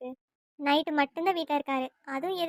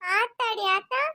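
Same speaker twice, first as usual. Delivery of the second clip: higher, complaining village woman's voice; quarrelsome village woman; 3 semitones higher than usual. Acted. Neighbour woman protesting to her elder sister, higher and complaining. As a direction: whine and pick a fight with the older sister.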